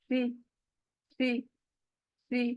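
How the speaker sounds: सी said softly with the tongue out between the teeth; soft lisped सी, like English th; teacher showing the th sound. Shown as a demonstration. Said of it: "जबान बाहर को आ रही है".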